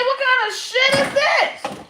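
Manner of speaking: shocked. Retold or imitated. At the sight of Greek statues, a high, shocked outcry. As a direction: exclaim in disbelief, voice jumping way up. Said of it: "I say".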